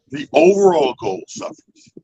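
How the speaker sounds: slow, weighted "overall goal suffers"; a warning said slowly; slow emphasis. Shown as a demonstration. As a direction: land each word, stretching "overall". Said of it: "Let me say that again".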